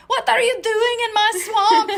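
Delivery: loud, high, hammed-up character yell; screamed movie quote. Explaining a random urge to say soundbites, screamed impression. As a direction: bellow it like an outraged swamp dweller. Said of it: "the reason why I scream".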